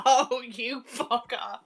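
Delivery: slow, laughing mock outrage; laughing curse. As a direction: laugh through a playful insult. Retelling reaction, laughing.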